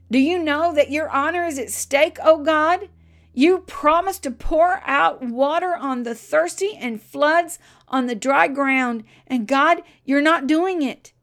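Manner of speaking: loud, high, impassioned prayer; crying out to God. Retold or imitated. Blacksmith challenges God in prayer, loud impassioned cry. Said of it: "looking up toward the heavens, he cried".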